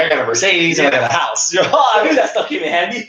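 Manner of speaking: high-pitched playful boast, laughing into it; teasing mock brag. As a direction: tease a friend by acting out his boast. Teasingly voices the other man bragging.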